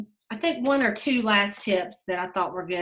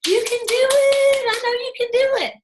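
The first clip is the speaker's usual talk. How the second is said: much higher, louder, sugary cheer voice; mock cheerleader; 5 dB louder than usual. Mocking what broke friends would never say, high cheer. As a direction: squeal it like a fake supportive friend. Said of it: "do you think they're gonna go".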